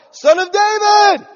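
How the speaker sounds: long, high, full-volume imitated yell; acted beggar crying out at full force; loud yell. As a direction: holler it slowly over a crowd. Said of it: "He just yelled loud".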